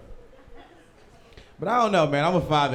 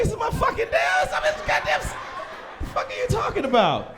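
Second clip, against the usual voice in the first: high, shrill-edged outraged shout; outraged yell. Retold or imitated. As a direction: yell it at the staff, insulted. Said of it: "I be like".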